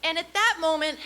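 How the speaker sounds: ordinary talk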